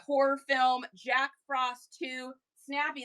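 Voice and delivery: ordinary delivery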